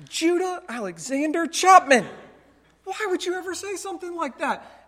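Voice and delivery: dad using the full name, half-hammed; imitating a shocked father calling out his son; stern dad. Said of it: "And I'm like".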